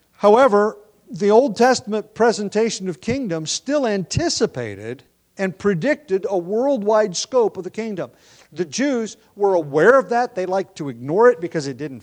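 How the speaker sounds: preaching. Real, not acted. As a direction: drive the point home to the church. Preaching builds to full force.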